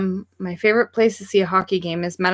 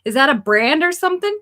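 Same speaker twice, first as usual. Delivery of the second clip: confused customer; 7 semitones higher, 4 dB louder than usual. Retold or imitated. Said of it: "And she was like".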